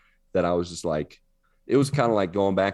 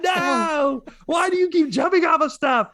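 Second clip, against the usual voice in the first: very high, loud, hammed-up yelling; mock exasperated shout; 6 dB louder than usual. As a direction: shout in mock despair at the character. Imagined player yelling at game character.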